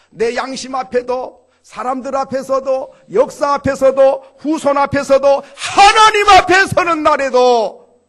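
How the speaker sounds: 낮게 시작해 외침으로 쌓아 올리기; 한 구절마다 더 크게, 마지막은 천천히 높게; 점점 커지는 외침. Real, not acted. (청중에게 점점 커지며 외치는 설교)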